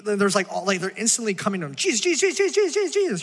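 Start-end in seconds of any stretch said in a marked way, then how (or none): 1.8-3.2 high, frantic pleading calls; clamouring crowd. Retold or imitated. Pastor mimics the desperate crowd, high frantic calls. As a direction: mimic a crowd mobbing him for help.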